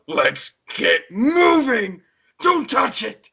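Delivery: hammy high dramatic shouts; over-the-top Shadow. Retold or imitated. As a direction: overact it like an anime hero. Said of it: "how extreme his voice was".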